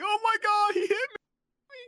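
high-pitched loud wailing, drawn out; hammed-up tearful shriek of a hurt kid; mock crying. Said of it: "starts crying".

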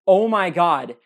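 loud retold scream of alarm; imitating a sudden loud shriek. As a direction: coworker shrieking at a dark parking lot, mock loud scream. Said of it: "She screams".

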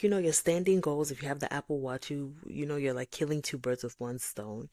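ordinary delivery

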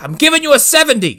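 high, loud and fast, hammed-up; fast, high yell playing the hammy actor; mock over-the-top yell. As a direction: blast it like a wild overactor. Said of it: "Just starts yelling at people".

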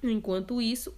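usual speaking voice

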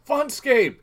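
high, loud shout across the room. Retold or imitated. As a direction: call out to get his attention. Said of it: "I, I shout".

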